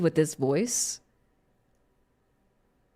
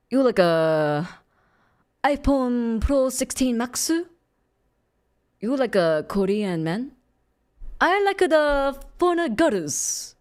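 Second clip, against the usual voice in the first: halting, hammed-up Korean accent; comically heavy Korean accent. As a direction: lay on a thick Korean accent for laughs. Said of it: "with a comically heavy Korean accent".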